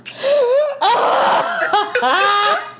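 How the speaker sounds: high wheezing laugh; laughing hard. Real, not acted. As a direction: laugh till you cry. Crying with laughter.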